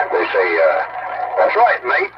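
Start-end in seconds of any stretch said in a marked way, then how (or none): 1.4-2.1 a light Aussie twang on mate; high, light Australian imitation; Australian accent. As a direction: say it like an Australian bloke. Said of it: "they just say things like mate".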